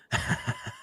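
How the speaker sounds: short awkward giggle; nervous laugh. Real, not acted. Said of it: "It's a nervous laugh".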